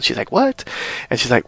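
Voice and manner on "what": high squeaky what; a pitch-jump of fake shock; mock shocked gasp. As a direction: squeak it out in disbelief. Retold Belle shock.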